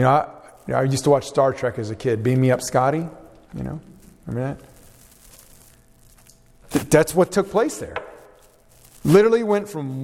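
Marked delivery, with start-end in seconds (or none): 3.5-6.7 slow whisper; whispered aside. Real, not acted. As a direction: breathe the question slowly. Aside to the audience.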